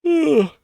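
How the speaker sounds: loud, high yawning groan; big yawn. Real, not acted. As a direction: let out a huge noisy yawn. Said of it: "Excuse the yawn".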